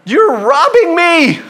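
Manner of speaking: high, loud, drawn-out protest; exasperated parent shout. Acted. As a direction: shout it like a robbed parent, slowly. Mock parent's outburst at ungrateful child.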